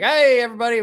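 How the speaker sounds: high, loud hearty greeting; calling out hello. Retold or imitated. Dad greeting everybody at the marina, loud hearty call. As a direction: call it out to the whole group.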